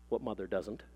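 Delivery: low, soft undertone; dry aside. A narrator's reading. Mother confides between her grand claims, dry low aside. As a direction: drop it in as a private comment.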